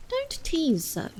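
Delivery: Alice, exasperated. Acted. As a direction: beg it to stop teasing. Alice to the gnat.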